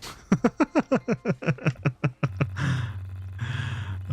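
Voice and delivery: long rueful laugh. Real, not acted. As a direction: laugh helplessly at the mess. Laughs at own failure.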